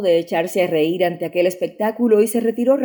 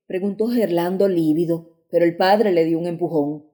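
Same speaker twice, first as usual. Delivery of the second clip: plain reading voice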